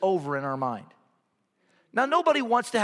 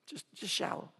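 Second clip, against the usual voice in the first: whispered; 14 dB quieter than usual. Acted. Preacher drops to near silence.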